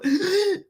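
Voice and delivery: imitated gasp of having the wind knocked out; sharp high gasp, like a blow to the ribs. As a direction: mimic getting winded. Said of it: "it knocks the wind out of you".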